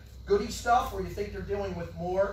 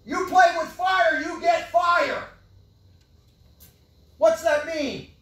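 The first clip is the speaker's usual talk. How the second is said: much higher, louder, full shout; preacher yelling; 9 semitones higher, 7 dB louder than usual. Real, not acted. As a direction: shout it down from the pulpit. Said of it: "You know why I'm yelling?".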